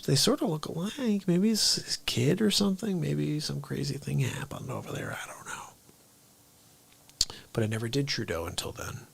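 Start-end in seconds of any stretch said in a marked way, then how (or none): none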